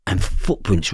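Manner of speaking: ordinary delivery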